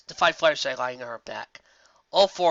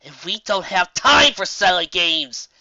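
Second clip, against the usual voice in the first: louder, slow, sharp retort; snapping; 5 semitones higher, 8 dB louder, slower than usual. Acted. Twilight snaps back at Dash over the headset, louder. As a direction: snap it back at her. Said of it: "she snapped back".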